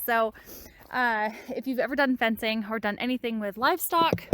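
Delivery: normal talking voice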